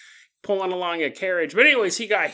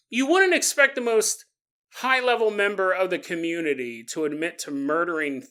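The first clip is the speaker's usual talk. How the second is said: normal talking voice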